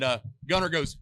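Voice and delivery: ordinary delivery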